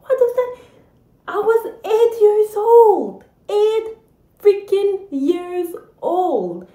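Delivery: high, loud exasperated protest; outraged disbelief. Real, not acted. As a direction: rant about the injustice, pitch way up. Outraged at childhood insult.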